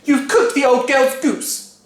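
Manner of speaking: high, slow accusing wife; fussy wife voice. A narrator's reading. Wife scolding her husband, high and slow.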